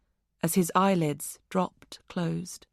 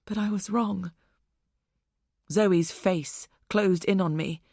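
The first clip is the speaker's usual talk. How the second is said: ordinary delivery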